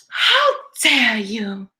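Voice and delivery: put-on slow, drawn-out Greta Thunberg accent; mock-indignant Greta Thunberg accent. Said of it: "Did I get the accent right".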